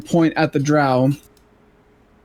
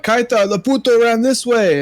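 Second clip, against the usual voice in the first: raised-pitch call to a companion, lightly acted; calling out in character; 6 semitones higher than usual. Player pointing Kaita down the hall, mildly acted call. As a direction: call out the direction to an ally. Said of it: "I'm gonna be like".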